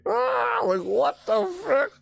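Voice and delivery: very high panicked squeal; shocked shriek. Real, not acted. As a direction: screech it in horror. Shrieking at trailer.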